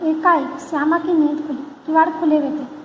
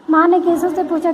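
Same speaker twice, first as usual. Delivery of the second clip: plain reading voice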